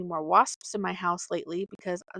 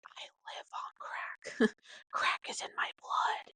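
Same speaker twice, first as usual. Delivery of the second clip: crazed dog whisper; 6 dB quieter than usual. Retold or imitated. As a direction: hiss it like a confession of madness. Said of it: "And Freya's like".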